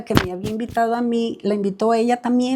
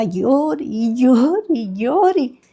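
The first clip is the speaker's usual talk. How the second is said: slow, singsong run of nonstop tears; drawn-out retelling; 3 semitones higher, much slower than usual. Retold or imitated. Woman retelling how a boy cried on and on, drawn out. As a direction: stretch each repeated word to show endless crying. Mimics the boy's nonstop crying.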